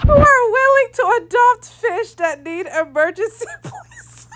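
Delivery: squeaky high laughing while reading; real laughter swallowing the words; cracking up. Real, not acted. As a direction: try to read on through helpless laughter. Cracks up reading Alex's comment.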